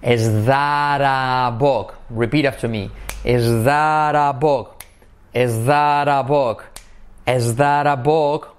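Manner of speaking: slow, "that a" as "thar a" with a tapped r; "Is that a book" said slowly as "es thar a book"; soft R linking. Shown as a demonstration. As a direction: flap the t of "that" into a soft r. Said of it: "se convierte en una R suave".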